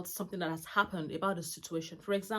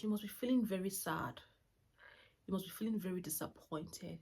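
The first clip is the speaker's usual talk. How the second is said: soft soothing; 6 dB quieter than usual. Retold or imitated. Mother soothing sad son.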